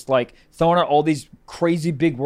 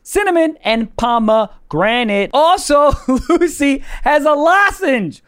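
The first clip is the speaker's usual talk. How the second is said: high, loud excited ad read; hyped ad read; 6 semitones higher, 6 dB louder than usual. Acted. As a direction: sell it with big hype. Hypes ad read.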